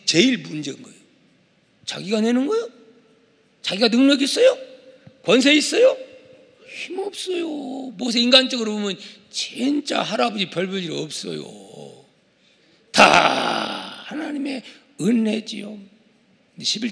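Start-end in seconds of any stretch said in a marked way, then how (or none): none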